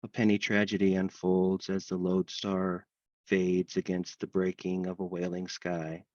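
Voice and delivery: measured, even recital in long lines; poem reading. Acted. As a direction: intone the verse steadily, pausing between lines. Host recites a dog poem.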